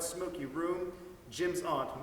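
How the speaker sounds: usual speaking voice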